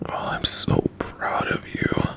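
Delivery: tender whisper; just breathe the words; whispered praise. Acted. Parent hugging the brave child, whispering.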